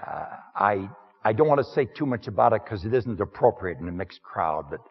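lightly voiced humble outsider, plain-spoken; first-person sermon character. Preacher speaking to the congregation as the Samaritan woman's man, lightly voiced. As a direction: step into the role of the woman's man, understated.

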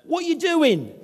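loud, high-pitched Cockney heckle; Cockney shout. Retold or imitated. Young man calling to the vicar over the fence, Cockney shout. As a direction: yell it out in a Cockney accent. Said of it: "he was shouting out in his Cockney accent".

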